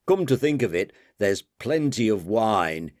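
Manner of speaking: usual speaking voice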